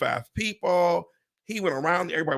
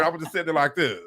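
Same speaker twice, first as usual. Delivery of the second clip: ordinary talk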